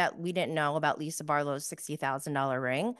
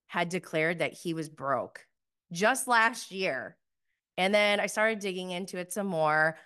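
usual speaking voice

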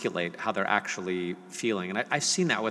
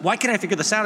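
high, loud frustrated cry; imitating his frustrated son, higher and louder; 11 semitones higher, 6 dB louder, faster than usual. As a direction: whine like a kid stuck on homework. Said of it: "He's like".

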